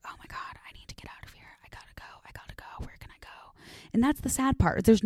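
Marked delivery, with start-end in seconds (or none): 0.1-3.5 urgent unvoiced whisper. To herself while mom rants, a panicked whisper. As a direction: whisper it like you need to escape.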